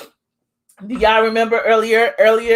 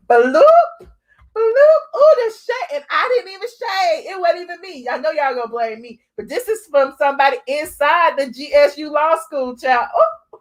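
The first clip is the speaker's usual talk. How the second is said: gleeful shriek; 5 semitones higher than usual. Real, not acted. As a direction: squeal over the juicy shade. Reacting gleefully to the shade.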